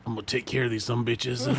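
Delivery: a hint of a smug tough guy; imitating the demonologist. Retelling a demonologist's boast about the ghosts, low smug. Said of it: "And he's like".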